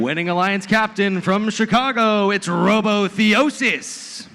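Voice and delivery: loud, drawn-out hype announcer call; fighting game announcer. Acted. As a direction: stretch the team name like a fight intro. Hype team introductions.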